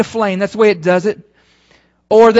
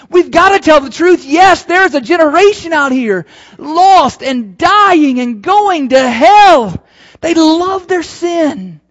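louder, slower urgent preaching; preaching at full force; 5 semitones higher, 6 dB louder, slower than usual. Acted. Pastor urging the church about the lost, preaching at full force. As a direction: hammer it home to the congregation.

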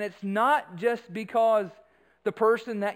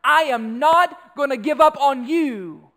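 loud, raised, slow and emphatic; forceful declaration; 5 semitones higher, 9 dB louder, slower than usual. Acted. Preacher summing up love for the congregation, loud and resolute. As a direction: proclaim it with full conviction, spacing the words.

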